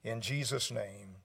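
low, measured praying with long pauses; closing prayer. Real, not acted. Pastor praying for the discouraged and those online, solemn prayer.

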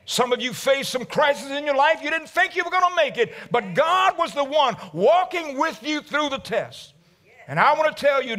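high, loud preaching; fervent pulpit delivery; preaching at full force. Acted. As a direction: drive it home to the church.

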